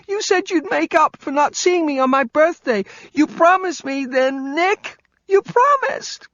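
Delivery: put-on high, tearful child pleading; upset tumbling words. Kelly pleading with Nick, upset gasping child. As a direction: let the words tumble out between gasps. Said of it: "punctuated by angry gasps for air".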